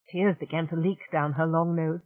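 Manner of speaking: plain reading voice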